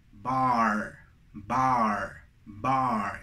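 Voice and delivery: "bar" with an open, drawn-out ah; pronunciation of "bar". Shown as a demonstration. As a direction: open the mouth wide on "bar", low and deliberate. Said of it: "La primera se pronuncia". ("bar" con A abierta, pronunciado despacio)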